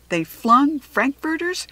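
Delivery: ordinary delivery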